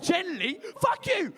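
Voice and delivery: mock furious yell at the joke; indignant outburst. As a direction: shriek back in mock outrage. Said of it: "she's like".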